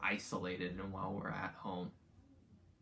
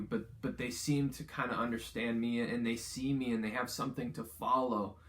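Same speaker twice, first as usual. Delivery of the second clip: upbeat youth pastor; 3 semitones higher, 4 dB louder than usual. Acted. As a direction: plead it like a heartfelt prayer. Paraphrased prayer, preached with intensity.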